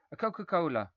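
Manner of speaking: high, loud sulky demand; bratty demand. A narrator's reading. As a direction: screw up your face and demand it. Boy screws up face, demands.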